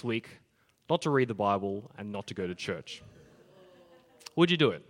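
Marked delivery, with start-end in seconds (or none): 4.3-4.8 challenging question. Shown as a demonstration. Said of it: "what if I said".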